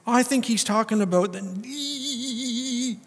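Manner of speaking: high, slow dopey guess; hammed-up dim voice; mock silly reader. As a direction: play the clueless Bible reader.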